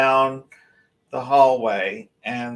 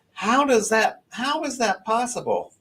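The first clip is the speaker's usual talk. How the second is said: higher amazed wonder; 10 semitones higher than usual. Real, not acted. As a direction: still amazed by the hit. Still amazed at event.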